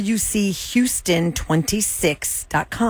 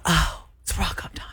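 hushed mock-dawning whisper; whispered realization; 5 dB quieter than usual. Voicing a surprised person's realization.

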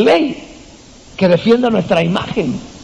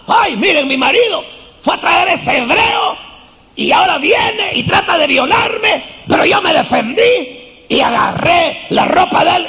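high, slow, shouted outrage; false accusing cry; 10 semitones higher than usual. Retold or imitated. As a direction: shout the lie to the crowd as a wronged wife. Wife falsely accuses José aloud. (la esposa de Potifar acusa a José a gritos, lenta y aguda)

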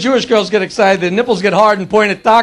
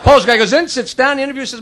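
usual speaking voice

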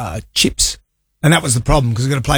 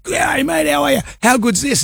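tipsy cheery greeting; 10 semitones higher than usual. Retold or imitated. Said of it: "And I said".

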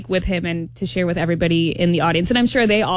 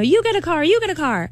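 excited host voice; 8 semitones higher than usual. Retold or imitated. Quoting a talk show host's giveaway.

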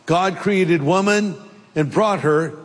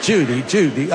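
Cary Grant; 3 semitones lower than usual. Retold or imitated. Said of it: "I sound more like Cary Grant".

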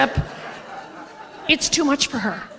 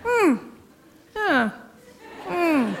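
soft disapproving hmm; quiet grumbling hmms over the menu; discontented hums; 7 dB quieter, much slower than usual. Retold or imitated. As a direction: hum unhappily at the menu. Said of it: "weird sounds of discontent".

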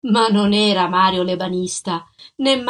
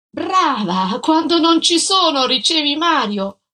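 higher, louder bitter sarcasm; sarcastic accusation; 6 semitones higher, 4 dB louder than usual. Acted. Jealous husband to his wife, sarcastic and angry. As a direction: throw the accusation at her.

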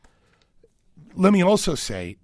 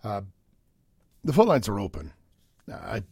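ordinary talk, nothing marked

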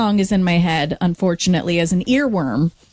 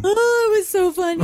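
high-pitched, amused laughter in the voice; giggly and much higher; real laughing; 9 semitones higher than usual. Real, not acted. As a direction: laugh helplessly as you remember it. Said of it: "I don't know why I'm laughing".

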